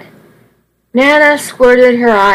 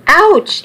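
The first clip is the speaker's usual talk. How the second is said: much higher ouch, yelped as he's hit again; the second blow hurts more, cry out higher; 9 semitones higher than usual. Acted. Nana whacking Charlie with cane.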